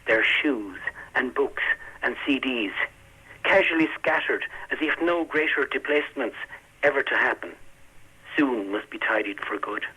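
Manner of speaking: slow, measured reading of a poem; poetry reading. Acted. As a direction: read it slowly with long pauses. Poet reads his poem aloud.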